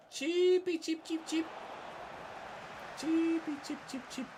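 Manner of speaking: very high squeaky chirps; bird chirping. Pretend. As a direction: tweet like a playful little bird. Imitating chirping birds.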